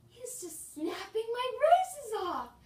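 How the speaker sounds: high, loud, slowed amazement; shocked disbelief. Retold or imitated. Retold shock at dentist.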